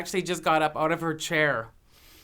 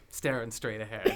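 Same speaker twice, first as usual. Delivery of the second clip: ordinary delivery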